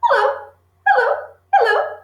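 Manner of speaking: high squawky parrot hello. Acted. Parrot greeting the two children in a high bird voice. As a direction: chirp it out like a talking bird. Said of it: "suddenly the parrot said".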